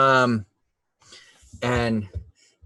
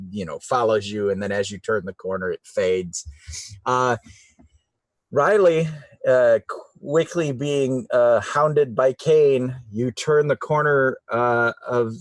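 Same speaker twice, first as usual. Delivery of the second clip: ordinary delivery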